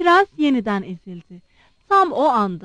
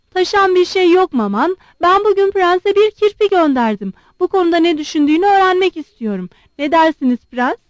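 higher, louder, bold and challenging; a proud girl standing up to her mother; high, loud daring girl's voice; defiant young woman; 6 semitones higher, 5 dB louder, faster than usual. A narrator's reading. Aglaé speaks to her mother.

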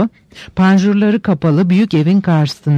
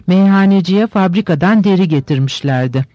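usual speaking voice

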